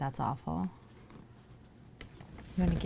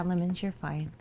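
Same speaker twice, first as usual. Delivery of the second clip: usual speaking voice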